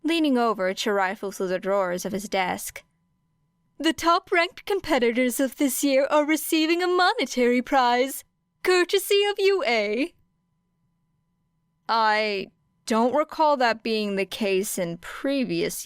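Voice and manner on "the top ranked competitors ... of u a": higher, beaming announcement; gleeful principal Nezu. Acted. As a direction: proudly present the prize. Nezu announces the prize money.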